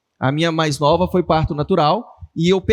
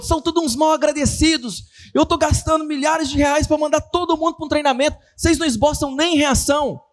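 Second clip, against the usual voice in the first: higher, indignant mock tirade; angry boss; 7 semitones higher than usual. Owner scolding his sales team for not reacting, angrily. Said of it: "ele ficou bravo".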